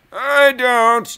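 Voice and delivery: high, loud retort; second self voice. Acted. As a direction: snap back as another self. Answering himself in dialogue.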